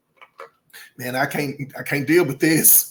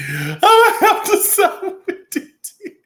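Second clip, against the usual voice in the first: high, laughing repeat; laughing harder; 12 semitones higher than usual. Real, not acted. Host repeating her verdict, cracking up. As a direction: let the laugh take over. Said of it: "I don't know why I'm laughing".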